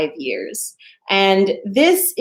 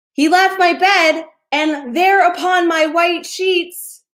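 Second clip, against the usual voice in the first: dramatic storytelling; 8 semitones higher than usual. A narrator's reading.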